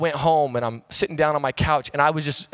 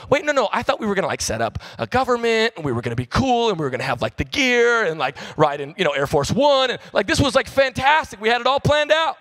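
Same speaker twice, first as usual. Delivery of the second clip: eager protest; 7 semitones higher than usual. Acted. Disciples protesting their plan failed.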